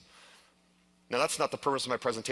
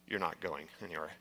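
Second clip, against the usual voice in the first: much softer and lower, grim and final; ominous low warning; 6 semitones lower, 9 dB quieter than usual. Retold or imitated. Tour guide telling him he's stuck, low ominous hush. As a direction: drop the voice low and soft.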